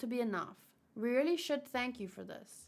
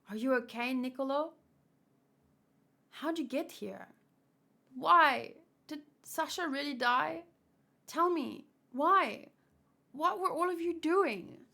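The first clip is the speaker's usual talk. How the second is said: a pleading friend, voiced higher and louder; distraught friend; 5 semitones higher, 4 dB louder than usual. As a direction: demand answers about Sasha's death. Reads a distraught friend's lines.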